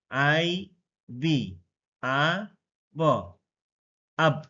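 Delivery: teacher's slow blend into আব, vowel as "ah"; ah-vowel phonics demo. Shown as a demonstration. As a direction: slowly spell আইবি, sound আ and ব apart, then blend আব. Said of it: "আই এর যদি আ উচ্চারণ নেই".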